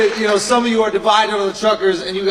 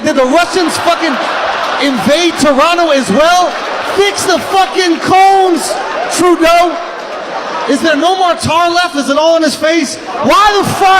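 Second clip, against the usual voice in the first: ranting yell; 6 semitones higher than usual. Retold or imitated. Driver yelling at Trudeau about road cones. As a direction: bellow it at the government. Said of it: "I'm like".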